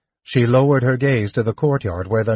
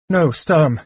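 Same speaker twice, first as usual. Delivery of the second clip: high, frightened protest; fearful refusal; terror; 5 semitones higher than usual. A narrator's reading. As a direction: cry out in alarm, pitched up. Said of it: "Lorana shivered with terror".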